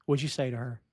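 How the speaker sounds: soft, quick, and calm even with the swearing; mild-mannered. Retold or imitated. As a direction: ask it gently, not angrily. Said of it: "Very mild-mannered".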